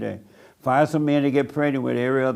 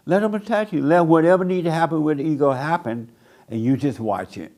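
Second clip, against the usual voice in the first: normal talking voice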